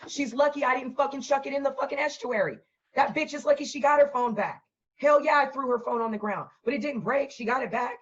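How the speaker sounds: ordinary delivery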